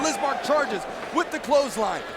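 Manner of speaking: ordinary talk, nothing marked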